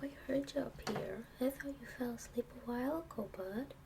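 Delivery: ordinary talk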